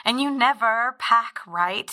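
ordinary talk

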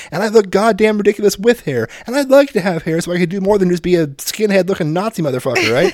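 mock tirade. Retold lecture to son.

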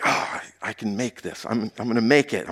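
low, hushed self-talk; soft retold pep talk under the breath; quiet inner thought. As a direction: mutter it to yourself, acting out determination. Acting out his struggling inner thought.

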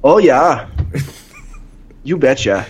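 put-on folksy Minnesota accent, you betcha. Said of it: "Would you say it in a Minnesota accent?".

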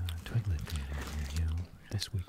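very soft, low whisper; murmured theatre announcement, barely voiced; hushed host. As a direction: fade away into a whisper. Segment host trailing into whisper.

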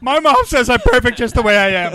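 high, loud teasing impression through laughter; hammed-up defensive mama's darling; mock self-satisfied whine. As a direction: play the coddled kid, laughing. Mocking someone who refuses criticism.

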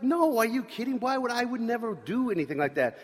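high, mock-shocked protest; indignant denial. Kid feigning shock at his friend's accusation. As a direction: act offended that he'd even suggest it. Self denying to Scott.

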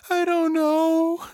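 very high, drawn-out wail; long despairing cry. A narrator's reading. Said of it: "Miriam wailed".

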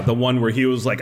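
ordinary delivery

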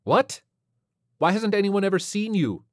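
high, incredulous questioning; baffled lieutenant. Acted. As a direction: question the alien in disbelief.

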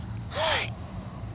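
surprised gasp. Real, not acted. Surprised by slime question.

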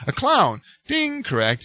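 high, bright quiz answer; game show contestant. Retold or imitated. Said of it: "she says".

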